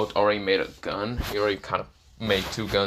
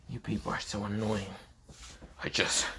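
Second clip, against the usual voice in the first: soft, under-the-breath exasperation; weary annoyance; 9 dB quieter than usual. Acted. Issei tells attackers they are annoying, weary and hushed. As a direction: let the irritation trail off quietly. Character sighs, fed up with opponents.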